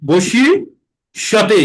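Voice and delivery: বশিষ্যতে split into slow syllables. Shown as a demonstration. Teacher breaks the word for students. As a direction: spell it out by sound.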